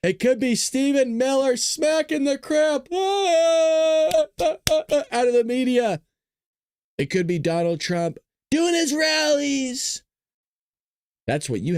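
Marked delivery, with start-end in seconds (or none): 0.0-10.0 high-pitched, drawn-out ringside announcer shout; hype announcer. Acted. Livestream host hyping tonight's briefing lineup, hype announcer. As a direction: belt it out like a show opener.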